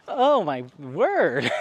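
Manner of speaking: slow, drawn-out "oh my word"; drawn-out mock shock. Vlogger imitating a shocked viewer, slow mock shock. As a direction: stretch it out in fake astonishment.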